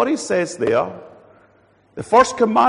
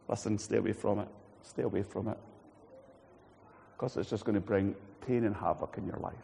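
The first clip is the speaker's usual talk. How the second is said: soft caring warning; 7 semitones lower, 12 dB quieter than usual. Retold or imitated. Loving God warning quietly.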